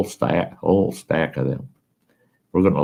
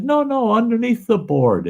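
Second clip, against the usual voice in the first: high teasing call. Retold or imitated. Pointing Chuck to the snake under the board, high call. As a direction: voice jumps much higher, lightly mocking.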